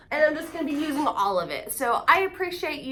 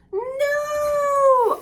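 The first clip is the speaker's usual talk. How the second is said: high no, then slow sad drawn-out line; mourning a broken mug; dismayed wail; 12 semitones higher, 4 dB louder than usual. Real, not acted. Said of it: "I'm so saddened".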